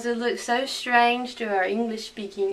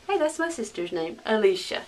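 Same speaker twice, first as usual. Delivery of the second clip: ordinary talk, nothing marked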